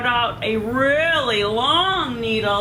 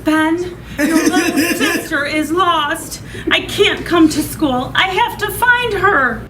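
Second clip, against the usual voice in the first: worried mother; 8 semitones higher, 3 dB louder than usual. Acted. Mother worried about lost child.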